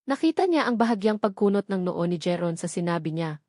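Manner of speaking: usual speaking voice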